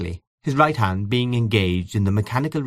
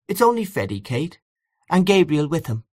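higher-pitched, plain answer with slight surprise; simple, mildly surprised; 7 semitones higher than usual. A narrator's reading. Julia turning to her sister, simply and faintly surprised. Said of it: "said simply, as if the question had surprised her".

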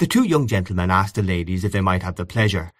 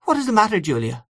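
mildly anxious, high-pitched question; 12 semitones higher, faster than usual. A narrator's reading. Aunt Kate to her sister Julia, mildly anxious. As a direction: ask with a touch of worry, starting high. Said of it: "asked Aunt Kate anxiously".

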